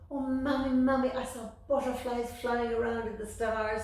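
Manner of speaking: put-on high, loud, eager little boy; bursting to tell his mammy; excited child. Little Amal excitedly telling his mammy about the moon.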